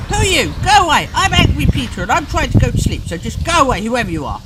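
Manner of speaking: put-on loud, high, furious child yelling go away; angry shout. Parent voicing Peter yelling at the little man, angry shout. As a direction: bellow at the intruder to leave.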